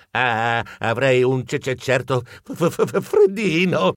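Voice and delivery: stuttering with cold; halting, shivering stutter on the consonants; shivering stammer. Acted. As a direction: stammer through chattering teeth. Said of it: "Così balbettai".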